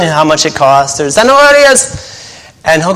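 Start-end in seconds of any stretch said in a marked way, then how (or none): 1.1-2.1 high, long-held vendor cry; mimicked drawn-out sales call; imitated street vendor's yell. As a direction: stretch it out high like a hawker. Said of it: "walk by and yell".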